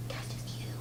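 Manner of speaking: soft hushed whisper. Real, not acted. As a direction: breathe it to the bird. Whispers to the bird.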